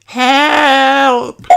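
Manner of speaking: high, loud hammed-up cry; mock cry for help. A narrator's reading. Peppa calls for help in the play, hammed-up. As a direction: yell help like a stage damsel. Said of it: "cries Peppa".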